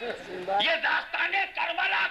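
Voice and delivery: high, ringing rhetorical cry; high-pitched rallying sermon delivery; full-force preaching. Real, not acted. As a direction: drive each question up to a drawn-out finish.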